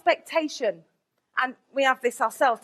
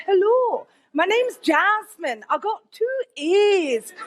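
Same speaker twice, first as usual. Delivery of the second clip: slow, higher, drawn-out mock-Scottish accent; put-on Scottish accent; 5 semitones higher, much slower than usual. Applicant phoning the next college to bluff, fake Scottish accent. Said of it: "in a Scottish accent".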